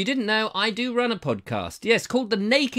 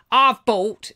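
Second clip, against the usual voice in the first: imitating Martin; 5 semitones higher, 6 dB louder than usual. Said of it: "'cause he talks like that".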